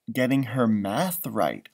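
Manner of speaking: ordinary delivery, nothing marked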